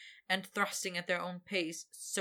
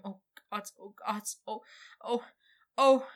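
overwhelmed moaning; 3 semitones higher, 6 dB louder than usual. Acted. Victor overwhelmed, crying out.

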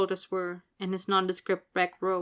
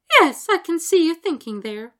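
higher, louder, upbeat encouragement; encouraging; 10 semitones higher, 10 dB louder than usual. Acted. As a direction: cheer the shy boy on. Hestia teasing Dudley.

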